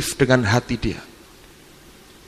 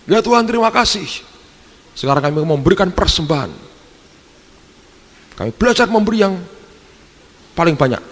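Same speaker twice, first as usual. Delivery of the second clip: mock pastor prayer; 7 semitones higher than usual. Preacher rushing the offering, hammed-up prayer voice. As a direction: ham up a greedy preacher's prayer.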